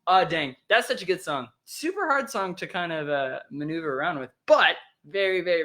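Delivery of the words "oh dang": usual speaking voice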